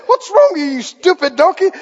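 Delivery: high, loud comic exasperation; loud play-acted telling-off; mock angry scolding. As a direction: scold a stubborn animal, hammed-up. Acted quarrel, Balaam scolds his donkey.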